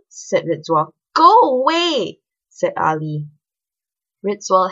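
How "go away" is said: loud, high, slow order; cross dismissal. A narrator's reading. Girl sending her schoolmate away from danger, loud cross order.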